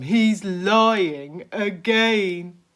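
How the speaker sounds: a worm fed up with lies; slow indignant Earthworm. Acted. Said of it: "shrieked the Earthworm".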